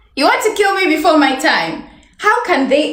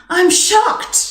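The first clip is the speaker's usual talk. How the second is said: bright example; 5 semitones higher than usual. Shown as a demonstration. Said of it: "So you can just say".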